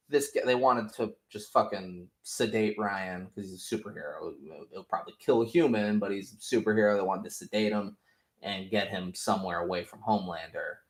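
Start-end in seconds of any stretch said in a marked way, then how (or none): none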